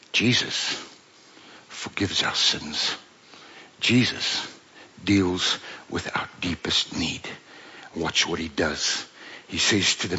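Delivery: whispered, soft and low; hushed proclamation. Real, not acted. Preacher quietly proclaiming forgiveness to the hearers. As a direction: draw them close in a whisper.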